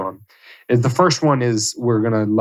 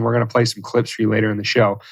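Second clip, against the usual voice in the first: usual speaking voice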